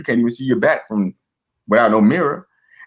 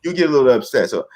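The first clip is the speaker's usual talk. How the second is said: ordinary talk, nothing marked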